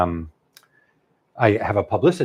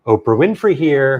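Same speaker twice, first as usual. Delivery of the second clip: loud, higher celebrity phone greeting; Oprah Winfrey; 5 semitones higher, 10 dB louder than usual. Retold or imitated. Star surprising an author by phone, imitated boldly. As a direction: announce yourself big on the phone. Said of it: "she goes".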